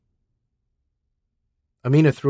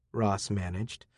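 ordinary delivery, nothing marked

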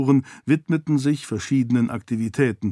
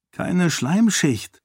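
slow, higher, stress on Keine; noting a fact; 6 semitones higher, slower than usual. A narrator's reading. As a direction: state Keine slowly and firmly, as an observation. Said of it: "stellte Karata fest".

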